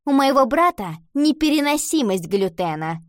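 pointed and certain; self-assured explaining. A narrator's reading. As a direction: announce it like a little expert. Girl explains with self-importance.

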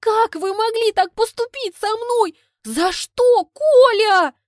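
high and loud, tragic plea; hammed-up heartbroken lament; melodramatic anguish. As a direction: cry it like a betrayed heroine. Melodramatic betrayal to Kolya.

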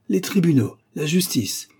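high, derisive exclamation; scornful. A narrator's reading. Masked man mocks the idea of courts, scornful. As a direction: toss the words back with contempt. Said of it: "Un éclat de rire méprisant lui répondit".

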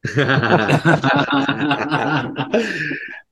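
long, hearty laugh; real laughter. Real, not acted. Host reacting to co-host's misogyny joke, real laughter. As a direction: laugh at length at a friend's joke.

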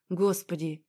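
plain reading voice

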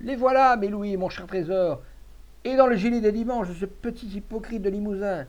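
high-pitched victorious outcry; triumphant yell. A narrator's reading. Victorious miser finding his gold in a housemate's waistcoat, triumphant yell. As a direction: cry out high, gloating over the find. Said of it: "hurla tout à coup l'avare victorieux".

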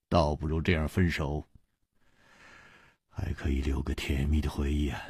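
低沉轻柔，带着伤感; 放下一段感情般低声说. A narrator's reading. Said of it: "楚留香黯然说". (楚留香黯然低语)

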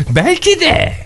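comically high put-on voice; high-pitched mock exclamation. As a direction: leap way up on "Belki de". Şakayla aynı sözü iki tonda söylüyor. (abartılı, çok tiz sesle)

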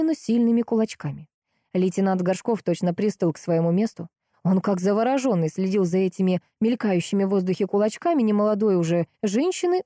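usual speaking voice

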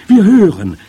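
high, very loud eager cry; shrill chorus-like call. A narrator's reading. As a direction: shout it as an excited audience. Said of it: "riefen die Tagungsteilnehmerinnen".

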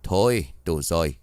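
a low, firm bark to stop the fight; old master's sharp command; stern snap. Acted. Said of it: "ông Bậu liền quát".

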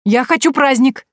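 put-on high, loud eager boy; excited boy. A narrator's reading. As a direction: beg for the party gleefully. Boy excited about party. (восторженный мальчишка, громко)

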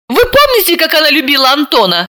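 high, quick exclamation; exclaiming. A narrator's reading. Sima exclaiming to the family, high and quick. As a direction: burst out reminding them. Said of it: "воскликнула Сима".

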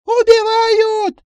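very high, drawn-out piercing scream; shrill screech. Acted. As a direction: shriek for help at full voice. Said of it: "пронзительно заверещала она".

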